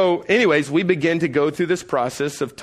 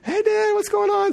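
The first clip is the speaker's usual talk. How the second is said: put-on much higher child's greeting; sweet kid voice. Sons greeting their father, sweet high child voice. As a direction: innocent little boys. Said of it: "they're all nice when Dad gets home".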